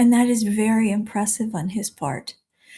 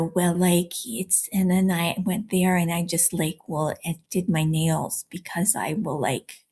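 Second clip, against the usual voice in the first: low, soft mock Californian; California accent; 3 semitones lower, 3 dB quieter than usual. Coach illustrating how Californians talk, low California accent. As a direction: sink into rambling Valley-speak. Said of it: "And the California".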